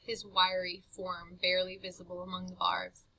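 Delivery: usual speaking voice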